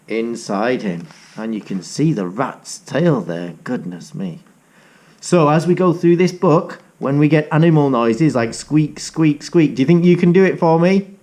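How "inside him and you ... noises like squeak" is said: usual speaking voice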